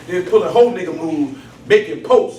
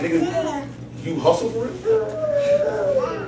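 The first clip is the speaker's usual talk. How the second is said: squeaky high, slowed mocking voice of the caller; 12 semitones higher, 6 dB quieter, much slower than usual. The caller who phoned him, mocked in a squeaky high voice. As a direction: mimic the dude with a high, drawn-out sneer.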